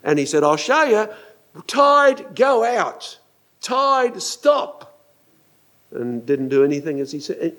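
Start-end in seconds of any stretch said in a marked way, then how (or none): 0.4-5.0 high, grand royal orders to the sea; hammed-up king issuing orders; commanding king. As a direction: command the tide in separate, ringing words. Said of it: "one of your famous kings who was trying to prove".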